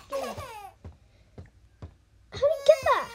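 high, loud alarmed cry; startled yell. Real, not acted. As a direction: shout it in real shock. Baby grabs something, startled.

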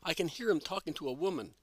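plain reading voice

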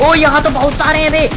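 suddenly spot a crowd of enemies; startled. Real, not acted. Spots many enemies at once.

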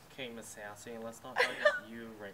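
laughing. Real, not acted. Teasing friend while laughing.

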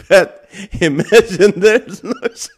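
high, loud, laughing so hard the word cuts off; breaking into laughter. Real, not acted. Streamer to her chat, pun breaking off into real laughter. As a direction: collapses into giggles mid-word.

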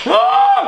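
very high shriek; scream. Real, not acted. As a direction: shriek in disgust. Egg dangling from mouth.

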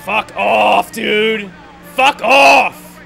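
loud, high raging yell; furious shout. Real, not acted. Racer screaming at rival driver, furious shout. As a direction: scream it at the car blocking you. Shouting at Kevin Harvick mid-race.

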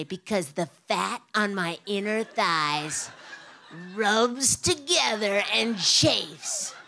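each word slow and loud; pointed slow explanation. Retold or imitated. Lecturing the police officers on chafing, slow and pointed. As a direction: spell it out slowly for them.

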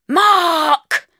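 yelled name. Acted. Natasha yelling for Mark from the stairs, high and loud. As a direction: yell for him impatiently. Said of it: "yelled Natasha".

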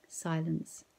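usual speaking voice

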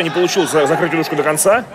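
usual speaking voice

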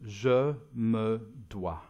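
"Je me dois" said slowly in French. Shown as a demonstration. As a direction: give "Je me dois" a slow French sound. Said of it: "I'll say it to you in French".

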